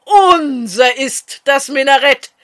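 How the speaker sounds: loud triumphant cheer; jubilant shout. A narrator's reading. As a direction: crow the victory out at full voice. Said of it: "So jauchzten die Schiriner".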